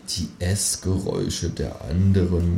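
soft, slow murmur. Acted. Narrator musing in the dark, quietly murmuring. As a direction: murmur it to yourself. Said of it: "murmel ich".

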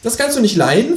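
high, falsely innocent kangaroo; feigned innocence. Acted. Kangaroo needles narrator, high and fake-innocent. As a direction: ask sweetly, pretending not to know. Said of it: "fragt das Känguru scheinheilig".

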